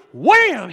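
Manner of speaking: a sudden loud smack of a word; loud mock impact; loud wham sound. Spanking story sound effect.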